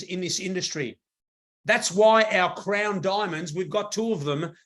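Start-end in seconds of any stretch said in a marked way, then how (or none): none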